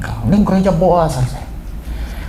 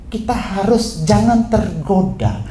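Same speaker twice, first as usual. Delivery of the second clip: normal talking voice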